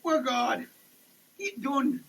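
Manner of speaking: ordinary delivery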